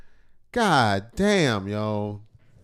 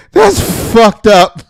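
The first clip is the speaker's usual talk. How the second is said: high, loud, drawn-out outburst; slow, stretched yell of disbelief; appalled shout; 9 semitones higher, 12 dB louder, much slower than usual. Real, not acted. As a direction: holler it slowly in shocked amusement. Appalled reaction amid laughter.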